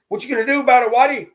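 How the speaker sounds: high, loud mocking taunt from a street kid; taunting youth. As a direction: sneer it up high like a cocky kid.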